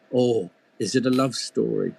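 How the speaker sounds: a hint of an old woman leaning in; doting old grandmother. A narrator's reading. Grandmother charmed by love story.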